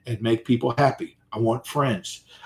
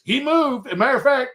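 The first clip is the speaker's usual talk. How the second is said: very high, agitated retelling; worked-up refusal, pitched way up; comic panic; 12 semitones higher than usual. Retold or imitated. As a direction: squeal it in mock alarm, breaking into a laugh. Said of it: "And I went like this".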